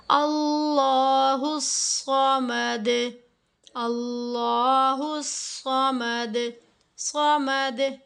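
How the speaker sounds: समद with its final द bounced back, slow; slow qalqala on samad. Shown as a demonstration. Teacher modelling the verse ending, slow rebounding d. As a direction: echo the द in समद. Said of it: "कलकला करना है".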